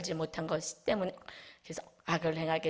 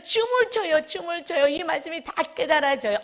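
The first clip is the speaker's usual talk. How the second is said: much higher and louder; ecstatic exclamation; 9 semitones higher, 5 dB louder than usual. Real, not acted. Preacher tells the church the Word dances, ecstatic. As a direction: cry it out in joy.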